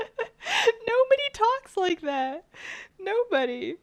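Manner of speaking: high laughing disbelief, slow. Real, not acted. Laughing at Siri's cookie answer, amused and disbelieving. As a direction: giggle through it, amused.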